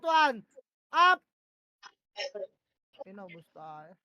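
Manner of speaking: normal talking voice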